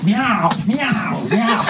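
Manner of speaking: high-pitched meowing; cat meow. Acted. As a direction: cry out like a cat. Performing as cat in carrier.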